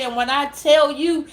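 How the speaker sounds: usual speaking voice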